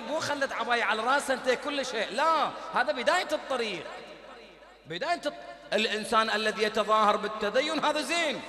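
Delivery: loud, forceful sermon delivery; fiery preaching. Acted. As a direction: exhort the congregation at full force. Preacher exhorting at full force.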